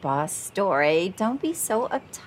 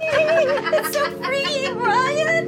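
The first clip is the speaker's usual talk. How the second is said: ecstatic; 5 dB louder, much slower than usual. Acted. Ecstatic while dancing.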